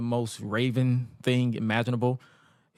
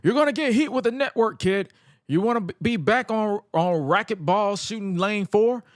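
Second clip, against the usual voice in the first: high-pitched berating wrestling promo; imitated scolding boss on the mic; Cyrus; 7 semitones higher than usual. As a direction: chew out an underling, pitched up. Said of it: "Cyrus ... is cutting a promo on the guy".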